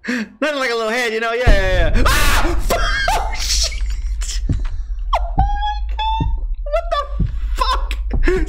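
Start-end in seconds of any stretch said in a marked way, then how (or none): 2.1-8.5 high laughing cries of disbelief; shocked laughter. Real, not acted. Streamer laughing hysterically after a scare. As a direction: laugh through the fright.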